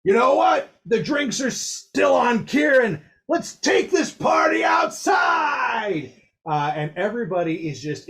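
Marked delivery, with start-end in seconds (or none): 0.0-6.1 showman's shout. Acted. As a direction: work the crowd like an arena star. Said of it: "he goes".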